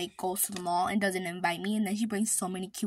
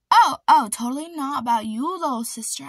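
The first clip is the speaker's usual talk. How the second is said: much louder, higher panicked protest; caught-out loud denial; 4 semitones higher, 12 dB louder than usual. Real, not acted. Caught gossiping by her sister, loud panicked denial. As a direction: shout the denial fast.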